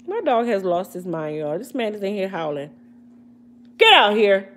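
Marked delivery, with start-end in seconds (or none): 3.8-4.5 firm shouted order; loud command to dog. Real, not acted. Owner ordering her dog out of the room, loud firm shout. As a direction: bark it at the dog to come out.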